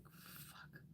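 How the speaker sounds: breathless whispered "Fuck"; a whispered swear at the bird; whispered curse. Retold or imitated. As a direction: curse under your breath, barely voiced. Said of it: "I'm like".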